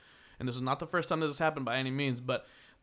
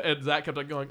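usual speaking voice

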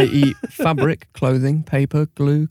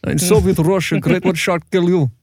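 put-on higher-pitched comic Russian accent; mock Russian accent; 4 semitones higher than usual. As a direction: play up a jokey Russian accent. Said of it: "that was more Russian".

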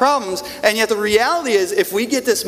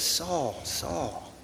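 soft calling; 13 dB quieter than usual. Retold or imitated. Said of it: "He says".

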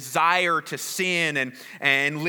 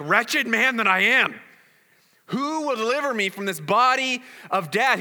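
exasperated; 5 semitones higher, 4 dB louder than usual. Retold or imitated. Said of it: "He's, like, exasperated".

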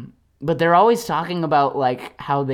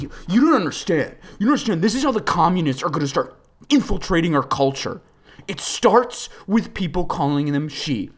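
preachy, indignant put-on voice, a bit louder; acting like an alt-righter; 4 semitones higher, 3 dB louder than usual. Mocking an alt-righter warning about communists, indignant. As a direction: lecture like a riled-up conspiracy guy. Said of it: "I'm acting like I'm talking to one of them".

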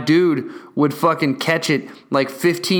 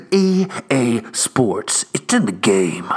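announcer slogan; 4 semitones lower, slower than usual. Retold or imitated. Quoting the EA Sports slogan again.

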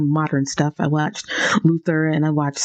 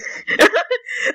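high giggle. Real, not acted. Giggles again after prompt.

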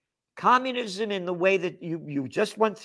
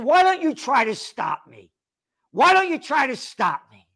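a louder, higher retold dare; repeated taunting dare; defiant dare; 8 semitones higher, 6 dB louder than usual. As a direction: throw the challenge back at him. Defiant challenge to guard.

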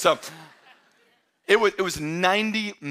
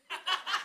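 a quick high chuckle; high short laugh; 12 semitones higher, 11 dB quieter than usual. Real, not acted. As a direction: laugh briefly at the joke. Preacher laughs at own joke.

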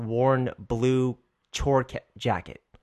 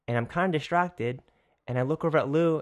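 ordinary delivery

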